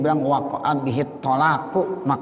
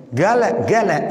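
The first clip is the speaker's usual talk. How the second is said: higher Egyptian "gala", hard g for qaf; Egyptian dialect; 4 semitones higher, 4 dB louder than usual. Shown as a demonstration. Teacher amused by the dialect, Egyptian-style "gala". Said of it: "Itu dialek".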